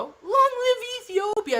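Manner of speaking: very high, whooped slogan; rallying cry. Pretend. Streamer hailing a viewer with a slogan, high rallying cry.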